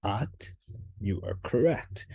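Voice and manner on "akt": "at" with an open ah and a k before the t, like "akt"; Ukrainian word. Shown as a demonstration. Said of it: "If you're thinking".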